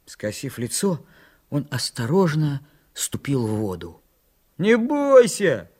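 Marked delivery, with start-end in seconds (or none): 4.6-5.7 high, loud, drawn-out call; encouraging call. A narrator's reading. Санька urges Митька on from the shore, calling loudly. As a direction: call it out slowly to urge on. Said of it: "напутствовал его с берега Санька".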